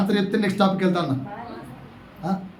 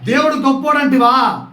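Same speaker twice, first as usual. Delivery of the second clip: Job rebuking wife; 5 semitones higher, 3 dB louder than usual. Acted. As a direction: scold her as Job would. Pastor reads Job rebuking his wife.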